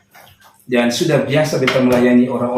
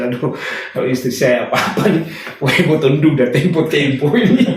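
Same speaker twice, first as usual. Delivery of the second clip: laughing joke. Retold or imitated. Joking about becoming widower.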